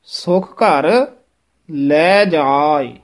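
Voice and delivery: very slow, ਸੁਖ ਘਰ joined, then ਲੈ ਜਾਏ; phrasing demo. Shown as a demonstration. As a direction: keep ਸੁਖ ਘਰ together, pause, ਲੈ ਜਾਏ. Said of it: "ਘਰ ਲੈ ਜਾਏ ਨਹੀਂ ਕਹਿ ਦੇਣਾ ਹੈ".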